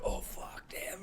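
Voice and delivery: hoarse whispered curse after a blow; winded whisper. Retold or imitated. Villain just hit in the chest, whispering. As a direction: breathe it out like you've just been hit.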